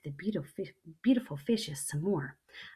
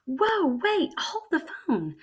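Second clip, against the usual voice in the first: much higher and louder, sudden alarm; startled whoa; 11 semitones higher, 8 dB louder, slower than usual. Acted. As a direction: jolt at the sight. Child startled by jellyfish.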